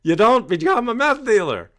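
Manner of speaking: loud, high firm refusal; stern parent shutting down son; indignant parent. Retold or imitated. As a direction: put the kid in their place. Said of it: "anyone with half a brain would go".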